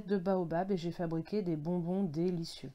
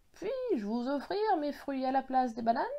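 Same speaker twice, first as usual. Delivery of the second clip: higher-pitched, sorry-sounding offer; apologetic; 5 semitones higher than usual. A narrator's reading. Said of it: "d'un air désolé".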